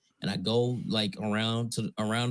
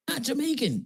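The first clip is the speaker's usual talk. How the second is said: high-pitched disbelief; 13 semitones higher than usual. Retold or imitated. Repeats retort after laughing.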